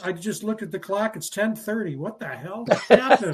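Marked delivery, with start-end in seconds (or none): 2.9-3.3 laughing. Real, not acted. Surprised at the clock, laughing.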